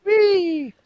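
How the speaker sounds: high, loud "whee" squeal; gleeful whee. Real, not acted. Cast member cheering as the group call starts, gleeful. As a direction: let out a high, playful whee.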